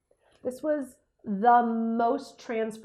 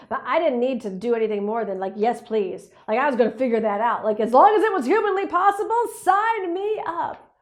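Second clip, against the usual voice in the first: excited; 10 dB louder than usual. Retold or imitated. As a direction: gush with excited enthusiasm. Retelling eager reaction.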